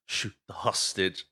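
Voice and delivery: soft, slow, quiet line; whispered. Retold or imitated. Retelling Jeff Daniels' hushed order, soft and slow. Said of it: "Jeff Daniels just whispers".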